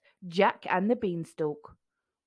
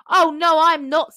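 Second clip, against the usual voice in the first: loud, high insistence; panto denial; 7 semitones higher, 11 dB louder than usual. A narrator's reading. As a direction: deny it like a pantomime.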